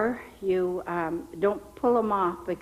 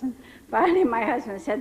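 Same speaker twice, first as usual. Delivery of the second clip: normal talking voice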